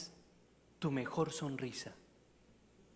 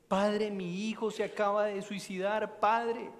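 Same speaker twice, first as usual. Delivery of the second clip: higher, louder anguished plea; mock grief; 6 semitones higher, 6 dB louder than usual. Grieving mother telling the priest of her son, anguished plea. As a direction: cry it out like a desperate mother.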